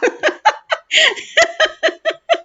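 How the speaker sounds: a long high cackling laugh; shrieking high laugh; high cackle. Real, not acted. Laughs at viewer's ha.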